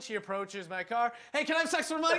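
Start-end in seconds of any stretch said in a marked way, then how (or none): 1.3-2.2 mock john asking. Mock role-play of a john.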